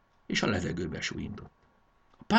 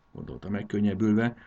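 usual speaking voice

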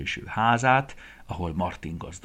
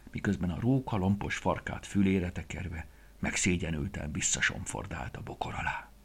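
usual speaking voice

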